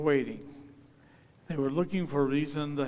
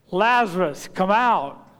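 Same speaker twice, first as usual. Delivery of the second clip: booming, raised-pitch command; loud cry; 4 semitones higher, 11 dB louder than usual. Acted. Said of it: "cried out with a loud voice".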